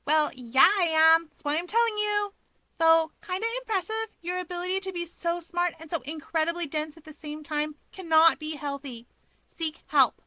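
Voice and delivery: put-on high, bright girlish teasing; a sassy friend lecturing him playfully. A narrator's reading. Rumi voiced high, teasing.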